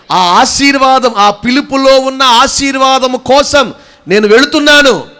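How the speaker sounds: high, loud, slow declaration; full-force preaching. Real, not acted. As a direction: proclaim it in long, ringing phrases. (గొంతెత్తి నిదానంగా ఉద్వేగ ప్రకటన)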